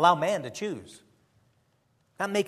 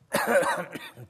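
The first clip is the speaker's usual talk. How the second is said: coughing fit; 3 semitones higher than usual. Real, not acted. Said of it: "Sorry about the coughing today".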